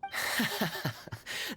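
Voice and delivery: gentle knowing chuckle. Acted. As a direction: laugh lightly, amused. Kaya amused by Paimon's hype.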